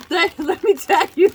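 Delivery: tickled before the reveal; about to crack up. Real, not acted. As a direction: burst into laughter as you begin.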